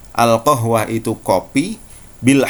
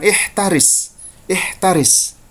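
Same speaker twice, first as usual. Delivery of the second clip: a Saudi Arabic warning, a bit higher; Saudi Arabic accent; 7 semitones higher, slower than usual. Shown as a demonstration. Teacher demonstrating the Saudi word for be careful. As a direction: warn like a Saudi speaker. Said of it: "Orang Saudi akan mengatakan".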